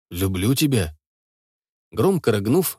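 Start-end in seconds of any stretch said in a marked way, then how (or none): 0.1-1.0 lower, tender repeat; low tender confession. Acted. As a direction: say it low and sincere. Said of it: "просто повторил свои слова".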